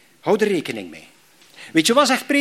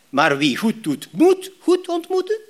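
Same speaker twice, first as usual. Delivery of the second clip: "moeten" rising much higher and slow, questioning; doubting question; 11 semitones higher than usual. Shown as a demonstration. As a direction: stretch the second half into a sceptical question. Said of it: "Begrijp je het verschil?". (twijfelend hoog en traag gevraagd)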